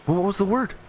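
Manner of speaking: high-pitched inquisitive mother; curious mom. Acted. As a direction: ask as a mom bursting with curiosity. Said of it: "out of sheer curiosity".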